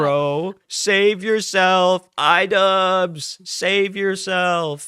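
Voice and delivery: hammed-up slow plea to save himself; mock solemn warning. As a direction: stretch each word like a dramatic warning. Urging podcaster to escape.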